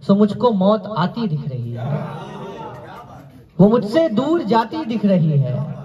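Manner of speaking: measured, projected mushaira verse; declaimed Urdu couplets; poetry recitation. Acted. Recites ghazal.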